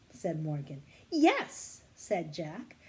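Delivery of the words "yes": eager yes. Acted. Jack eager agreement.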